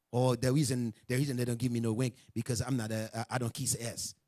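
low, soft mock complaint; acted sullen excuse, voice dropped low; mock grumbling excuse. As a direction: imitate a brother quietly grumbling. Voicing a complaining brother's excuse.

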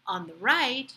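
ordinary delivery

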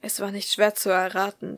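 plain reading voice

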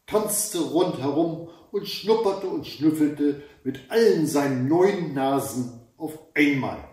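plain reading voice

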